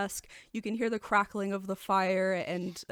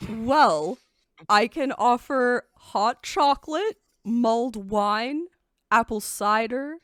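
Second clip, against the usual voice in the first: louder, welcoming innkeeper offer; 3 semitones higher, 8 dB louder, slower than usual. Acted. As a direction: list the drinks like a host. Innkeeper lists drinks.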